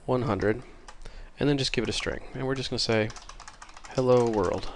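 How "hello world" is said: ordinary delivery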